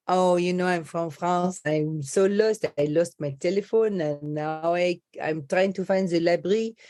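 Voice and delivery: put-on lost French tourist accent. Coach posing as lost French tourist asking directions, French accent. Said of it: "get lost as a French person".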